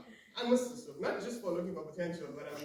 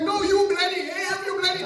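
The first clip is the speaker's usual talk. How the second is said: very high, very loud vendor outburst; acted irate yelling; mock angry insult; 13 semitones higher, 13 dB louder than usual. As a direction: hurl abuse in a hammed-up quarrel. Seller insults him in acted quarrel.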